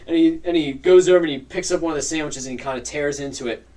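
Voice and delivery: normal talking voice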